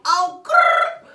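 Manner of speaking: loud high frustrated grr; sharp loud annoyed grr; annoyed growl. Real, not acted. Rod falls again, annoyed.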